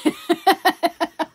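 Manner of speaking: loud high squealing laugh; burst of laughter. Real, not acted. Laughing at the odd sound she just made, loud squeal. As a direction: crack up loudly at the noise she made.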